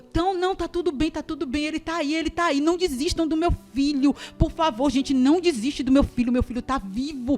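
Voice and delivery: high, loud begging; reenacted frantic, insistent mother; desperate pleading. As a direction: beg them not to give up. Mother begging them not to give up.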